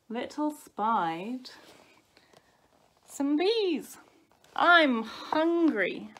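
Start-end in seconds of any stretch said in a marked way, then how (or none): 4.6-6.1 high, slow, loud whine; whining. A narrator's reading. Baby wolf to brother near the bees, whining. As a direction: whine like a starving little brother. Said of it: "whined Smells".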